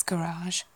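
"garage" as ga-RAHZH, stress at the end; end-stressed "garage", American style; American pronunciation. Shown as a demonstration. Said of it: "particularly in the States".

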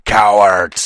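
loud enraged insult; furious shout. Acted. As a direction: spit it out as a yell. Fenrir's enraged outburst.

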